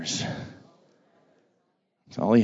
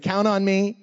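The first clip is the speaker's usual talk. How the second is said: shouted prompt; 4 semitones higher than usual. Shown as a demonstration. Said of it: "Let me hear it".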